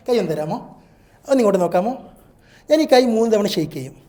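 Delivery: ordinary delivery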